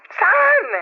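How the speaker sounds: high, startled "Saan?"; shocked question. Acted. Cousin startled by the wife's question, high and shocked. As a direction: jump up in disbelief on the word.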